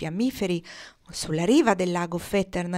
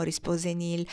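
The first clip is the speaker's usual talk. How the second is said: plain reading voice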